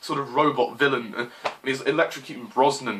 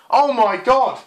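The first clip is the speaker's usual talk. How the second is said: loud, high cry of disbelief; exasperated outburst; 8 semitones higher, 5 dB louder, slower than usual. Real, not acted. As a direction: throw it out in exasperation. Exasperated at the lyrics.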